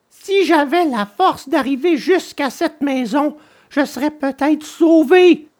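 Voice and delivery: high-pitched puppet voice; Pinocchio, hopeful and desperate. A narrator's reading. Puppet telling himself the house could save him, high and hopeful.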